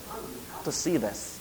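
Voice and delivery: wife softly calling her husband to come see, hushed; low, very soft retold line. Wife to preacher at his study, hushed. Wife's soft call in story.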